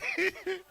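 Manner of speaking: a quick high-pitched laugh; short high laugh. Real, not acted. Jokes about crying on camera.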